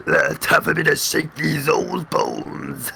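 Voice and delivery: put-on low slow old bones voice; lightly voiced old creaky skeleton. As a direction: drag it out like an ancient skeleton.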